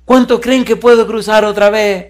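high, loud call to a crowd; hammed-up performer's challenge, pitched high; acted showman. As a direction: imitate a daredevil working up the audience. Acts tightrope walker addressing crowd.

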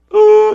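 high, loud mocking "uh"; a sudden shrill yelp of mock alarm; mock shriek. Preacher mocking the woman's tears with a loud yelp.

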